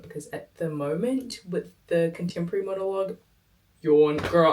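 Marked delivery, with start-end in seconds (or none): 3.8-4.5 mock yawn. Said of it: "I'm putting myself to sleep".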